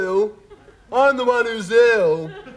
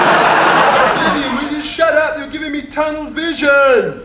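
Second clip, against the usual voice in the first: loud, high yell at his flatmate; shouting at his flatmate to shut up, loudly; 4 semitones higher, 5 dB louder than usual. Acted. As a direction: bellow at him to shut up. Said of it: "Stop shouting".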